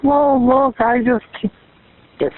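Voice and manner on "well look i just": much higher mimicking voice; mimicked interjection. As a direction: imitate her butting in, pitched right up. Mimics the woman talking over others.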